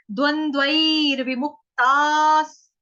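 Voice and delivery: slow, loud, drawn-out recitation. Shown as a demonstration. Teacher models the third verse line, loud and slow. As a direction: intone it slowly for the class. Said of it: "उसके आगे आ जाओ third चरण पे".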